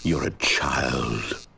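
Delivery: low, slow cold contempt; contemptuous. Acted. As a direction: put them down coldly. Narrator scorns player.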